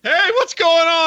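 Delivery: high, loud, drawn-out hello; stretched, hyped-up greeting to the guys; big shouted greeting. Pretend. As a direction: belt out an over-the-top show entrance. Co-host hams up his greeting.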